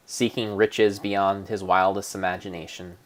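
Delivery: ordinary delivery, nothing marked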